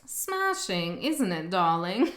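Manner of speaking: a hint of a British socialite accent, quick and playful; mock British accent impression; posh British accent. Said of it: "I really enjoy that accent".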